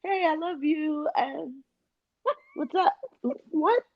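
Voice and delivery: high, sugary mock voice, a touch of a whimper; mock Audrey call. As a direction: play the friend who suddenly calls all sweet. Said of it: "and then you come back".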